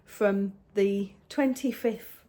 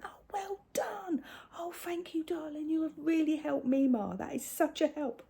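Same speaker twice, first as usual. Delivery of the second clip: put-on praising a toddler; 7 semitones higher, 3 dB quieter than usual. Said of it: "I went".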